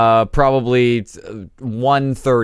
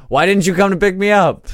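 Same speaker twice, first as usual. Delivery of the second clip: higher-pitched imitated reproach; mock complaint; 8 semitones higher, 4 dB louder than usual. Partner calling back late to complain, mock reproach. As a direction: imitate an indignant caller demanding answers.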